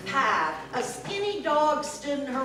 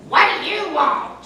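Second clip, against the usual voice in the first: loud, sharp witch demand; 4 semitones higher, 7 dB louder than usual. Acted. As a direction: bark it like a cranky witch. Witch snaps at Sasha.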